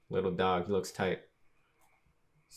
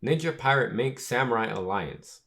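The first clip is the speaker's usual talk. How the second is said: tongue-twister name run together, higher; rattled-off mouthful; 4 semitones higher than usual. Shown as a demonstration. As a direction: reel off the whole mouthful in one quicker go. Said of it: "That's a freaking mouthful to say".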